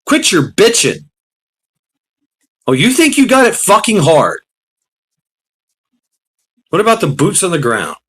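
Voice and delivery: angry retort. Retold or imitated. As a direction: snap back at whiners, fired up. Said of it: "I'm on the other end going".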